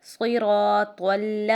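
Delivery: صراط ال said slowly, with the ط joined to the ل. Shown as a demonstration. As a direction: slide صراط straight into ال with no break. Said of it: "تو لام زبر طل". (صراط ال آہستہ ملا کر)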